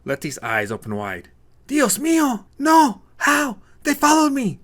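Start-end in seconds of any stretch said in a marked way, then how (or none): 1.7-4.6 high loud frightened outbursts; panicked cries. Acted. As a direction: cry out in terror, one burst after another. Letty panics at gang arrival.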